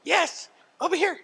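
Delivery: very high, loud excited dog play voice; calling from far away. Real, not acted. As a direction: squeal praise at a playful dog. Owner plays with dog, praising.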